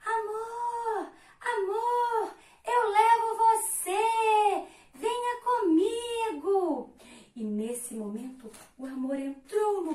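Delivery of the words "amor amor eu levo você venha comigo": high, slow welcoming call; calling out to him. Acted. Someone in a small boat calls Love aboard, high slow call. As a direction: call across the water, drawn out. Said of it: "alguém lá de dentro desse barquinho acenando".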